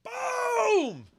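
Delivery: high excited shout of boom; real whoop at the jab; triumphant shout. Real, not acted. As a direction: yell it like a knockout landed. Celebrating the punch clip.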